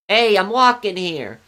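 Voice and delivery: loud, drawn-out New York street shout; New York accent. Shown as a demonstration. Streamer quotes a famous line for chat, New York accent. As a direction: call it out high and slow, New York style. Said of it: "that one too".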